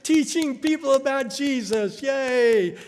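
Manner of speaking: high, excited cheering of fans; cheering praise. Retold or imitated. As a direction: cheer them on like adoring supporters. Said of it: "everybody'd say".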